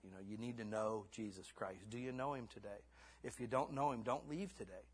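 ordinary talk, nothing marked

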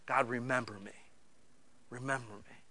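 soft, fading plea to be remembered; almost breathe it, lonely; quiet pleading prayer. Retold or imitated. A lonely believer asks God to remember them, in a soft, pleading voice.

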